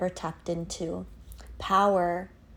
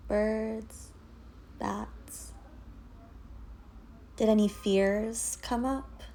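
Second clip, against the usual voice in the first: guided meditation. Acted. Yoga teacher leading listeners through a dark tunnel visualization, guided meditation. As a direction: guide them gently inward, leaving space after each phrase.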